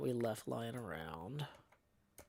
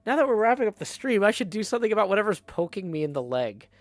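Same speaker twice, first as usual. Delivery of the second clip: exasperated self-quote; 5 semitones higher, 8 dB louder, faster than usual. Retold or imitated. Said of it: "I'm finally like".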